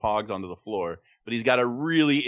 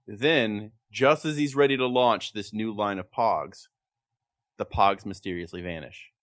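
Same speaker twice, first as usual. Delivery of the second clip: ordinary talk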